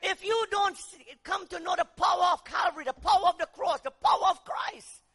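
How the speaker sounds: loud, driving sermon cry; fiery preaching. Acted. Preacher shouting to the congregation, loud and driving. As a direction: hammer the power of the cross home.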